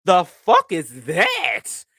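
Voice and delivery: slow, breathy amazement at first sight; stunned awe. Retold or imitated. Friend retelling first sight of Tony, stunned awe. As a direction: gape at him in amazement. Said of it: "and I was like".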